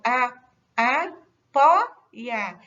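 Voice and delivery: "a, pó e a" split by pauses, "pó" loud; sílaba por sílaba. Shown as a demonstration. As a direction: punch "pó" as the stressed syllable of "a, pó e a". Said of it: "é a penúltima sílaba". ("pó e a" separado em sílabas, com "pó" forte)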